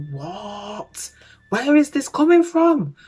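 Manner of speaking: hushed what, then high loud disbelief; acted incredulity. Onlooker doubting the suspect's story, rising incredulity.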